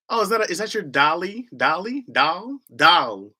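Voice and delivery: "dolly", "Doll?" tried with a short ah vowel; a struggling try at "doll". Shown as a demonstration. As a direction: practise "Doll" carefully, testing the vowel. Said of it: "I struggle saying".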